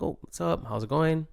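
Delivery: mildly stern, held-back hello; a little stern. Acted. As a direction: greet friends with guarded, understated sternness. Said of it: "A little stern".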